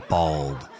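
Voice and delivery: plain reading voice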